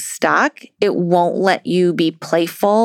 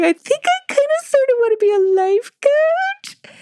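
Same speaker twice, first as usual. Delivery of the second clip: much higher, fragile, almost crying; teary confession. Retold or imitated. Coach retelling her tearful admission she wants to coach, high and teary. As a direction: admit it shyly through welling tears. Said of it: "And tears started coming to my face".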